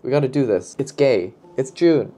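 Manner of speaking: normal talking voice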